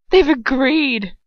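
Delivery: gloating. Acted. Bellatrix gloating.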